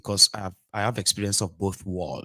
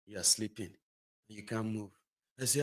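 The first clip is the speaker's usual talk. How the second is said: usual speaking voice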